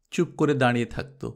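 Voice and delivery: ordinary delivery, nothing marked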